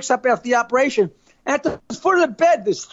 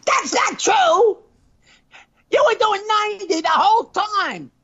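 higher, contradicting; nagging wife; 7 semitones higher than usual. Acted. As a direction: cut him off, up in pitch.

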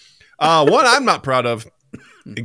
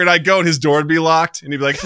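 usual speaking voice

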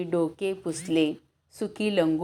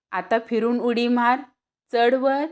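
calling out; 5 semitones higher, 5 dB louder than usual. Acted. Banya calls him to jump again.

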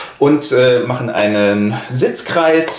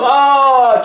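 high loud hammed-up cry; mock death scream; 11 semitones higher, 4 dB louder than usual. Gessler is struck by Tell's arrow, loud hammed-up scream. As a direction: yelp as if hit by an arrow.

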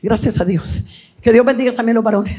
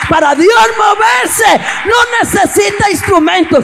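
high, forceful preacher's climax; full-force preaching; 6 semitones higher, 4 dB louder than usual. Real, not acted. Preacher tells the congregation God moves where knees bend, full-force preaching. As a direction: build to a ringing, high-pitched cry of exhortation.

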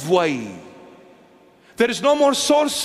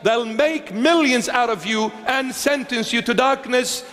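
ordinary delivery

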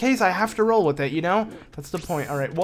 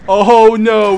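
loud, raised cry of dread; big, higher-pitched groaning alarm; dismayed cry; 6 semitones higher, 14 dB louder than usual. Real, not acted. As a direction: blurt it out loudly in dismay. New enemies appear in game.

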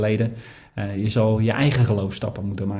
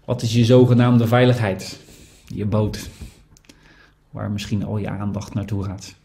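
guided reflection. Acted. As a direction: invite the congregation to listen inwardly. Leads a quiet reflection. (stille overdenking, zacht en traag)

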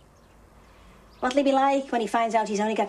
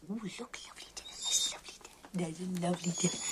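soft whisper, quick and sweet; whispered coaxing; 7 semitones lower, 10 dB quieter, faster than usual. Real, not acted. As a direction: tempt the dog in a hush. Coaxing the dog alone.

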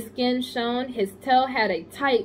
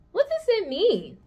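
puzzled Charlotte; 10 semitones higher than usual. Acted.

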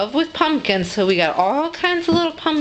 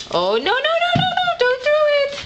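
much higher, sing-song no no no; high-pitched warning. Real, not acted. Warning toddler not to throw.

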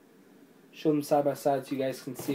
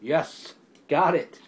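a loud, higher cheer of victory; real triumphant whoop; 4 semitones higher, 5 dB louder, much slower than usual. Real, not acted. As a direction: celebrate finally getting it off. Sticker finally peels off.

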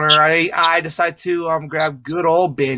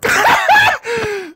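high, loud burst of laughter; loud laughing fit; 4 dB louder than usual. Real, not acted. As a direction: crack up helplessly, high-pitched. Cracking up at the joke.

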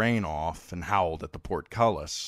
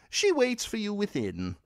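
higher, smooth courtly voice; a young noble full of smooth excuses, raised pitch; courteous Frey heir. Acted. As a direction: play a polite, eager host lord. Edwin Frey voiced again.

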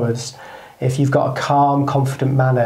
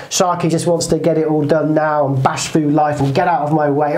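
ordinary delivery

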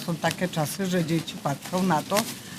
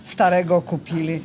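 normal talking voice

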